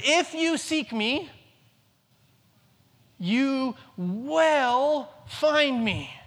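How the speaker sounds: slow and deliberate, stretching find; slow, drawn out. Shown as a demonstration. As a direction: lay God's promise out very slowly. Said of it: "He says".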